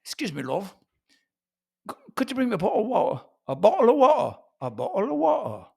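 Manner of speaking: working British glottal stops, "bo'le o' wa'er". Acted. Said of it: "They just skip the tea altogether".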